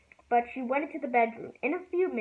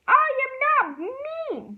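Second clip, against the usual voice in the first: high, loud, slow retort; shouting back; 14 semitones higher, 6 dB louder, slower than usual. A narrator's reading. Beezus shouting back at Ramona, high and loud. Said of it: "Beezus shouted back".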